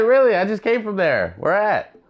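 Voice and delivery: high-pitched mock enthusiasm; imitated eager lady. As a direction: mimic her chirping interest up high. Mimicking her repeated questions.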